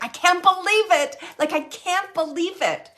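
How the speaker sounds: relayed proud amazement, pitched high; proud disbelief. Retold or imitated. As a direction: voice his delighted, incredulous pride. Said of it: "He's just so proud".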